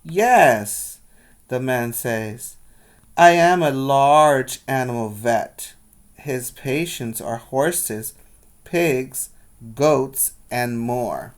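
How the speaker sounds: put-on lively read-aloud for children; storybook reading voice; story narration. A narrator's reading. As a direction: tell the story brightly to the class. Teacher reads picture book aloud.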